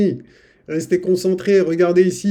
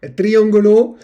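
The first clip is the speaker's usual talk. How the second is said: mock Spanish accent; 4 semitones higher, 6 dB louder than usual. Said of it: "on doit dire en espagnol".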